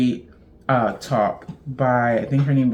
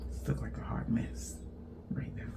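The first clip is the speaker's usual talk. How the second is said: whispered to herself, much softer; 17 dB quieter than usual. Real, not acted. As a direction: whisper it while fixing the mess. Mutters at tangled yarn.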